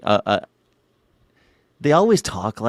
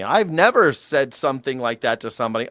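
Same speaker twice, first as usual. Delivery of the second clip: ordinary delivery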